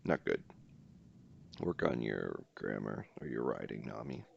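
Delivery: low, soft mock scolding; mock scolding teacher. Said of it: "repeated in a sentence".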